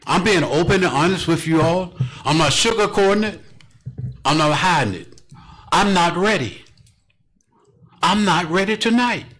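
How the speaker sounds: loud, emotional declaration, each statement driven home; impassioned plea. Real, not acted. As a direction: plead openly at full voice.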